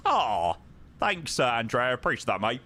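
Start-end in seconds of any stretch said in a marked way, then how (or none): none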